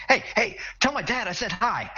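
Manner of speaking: put-on high, eager young hero voice; Luke Skywalker voice. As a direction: chirp it like an excited farm boy. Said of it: "Luke Skywalker sounding voice".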